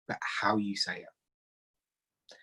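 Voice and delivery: slow, soft whisper; slow right down and breathe it. Real, not acted. Telling the audience how matters, slowly whispered. Stressing delivery over words.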